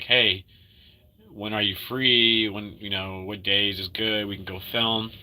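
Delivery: ordinary talk, nothing marked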